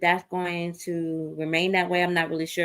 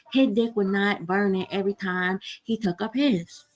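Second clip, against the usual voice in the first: ordinary delivery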